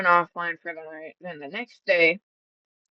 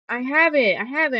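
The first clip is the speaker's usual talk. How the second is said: mimicking her insistence; 7 semitones higher, 6 dB louder than usual. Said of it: "she's like".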